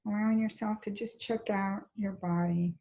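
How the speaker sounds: calm, even body-scan guidance with long pauses; guided meditation. Real, not acted. Teacher leading a class into a body scan, calm guided meditation. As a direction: guide them gently, leaving space between phrases.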